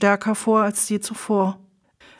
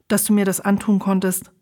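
usual speaking voice

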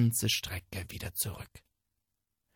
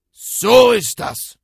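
very loud, slow furious roar; tiger roar; 10 semitones higher, 17 dB louder, slower than usual. Acted. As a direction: roar it in rage. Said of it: "brüllte der Tiger". (brüllender Tiger)

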